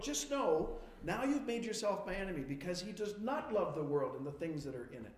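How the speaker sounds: ordinary talk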